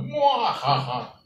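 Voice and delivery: slow evil villain cackle; hammy cartoon villain laugh; mustache twirling villain. Villain laugh after superhero idea.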